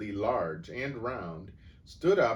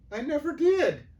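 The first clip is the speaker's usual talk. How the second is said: much higher, louder desperate cry; exclaimed; 9 dB louder than usual. A narrator's reading. Boy denying the theft, exclaiming. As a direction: blurt the denial. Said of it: "the boy exclaimed".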